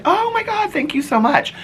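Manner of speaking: squealing high, thrilled oh my God; excited thanks. Retold or imitated. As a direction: gush with delighted surprise at the fan. Thrilled fan recognizes her.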